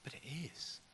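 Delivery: very soft, hushed reply; whisper. Acted. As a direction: whisper it as if to someone in a crowd. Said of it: "somebody else whispers".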